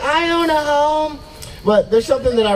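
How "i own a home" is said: high, slow prissy homeowner voice; mock homeowner boasting. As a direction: play the proud homeowner, drawn out and high. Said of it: "She's like".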